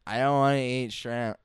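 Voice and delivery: loud, whiny kid imitation; mock a picky child's complaint; complaining. Imitating picky young friend at a restaurant, loud complaint. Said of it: "complaining already so much about the food".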